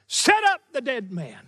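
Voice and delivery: "Set up" hit high, then falling away; emphasis on SET UP. Shown as a demonstration. Preacher stressing the Greek verb, "Set up" emphasised. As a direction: punch "Set up", drop down on "the dead man". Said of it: "put emphasis on the verb".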